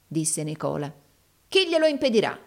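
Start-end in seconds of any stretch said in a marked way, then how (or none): 1.5-2.4 high-pitched, heated question; indignant, pitched well up; scornful challenge. A narrator's reading. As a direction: dare him to answer. Villain challenges the hero.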